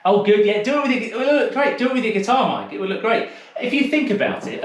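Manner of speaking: high, eager cheering on; excited encouragement. Real, not acted. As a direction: egg him on with real delight. Encouraging Mike with his guitar.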